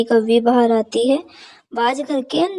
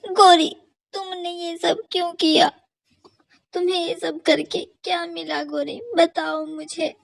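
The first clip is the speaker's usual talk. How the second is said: higher and much softer, wounded questioning; hurt, betrayed sparrow; 6 semitones higher, 5 dB quieter than usual. Acted. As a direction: ask why your friend betrayed you. Betrayed sparrow confronts crow. (धीमी टूटी हुई आवाज़ में सवाल)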